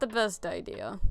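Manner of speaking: usual speaking voice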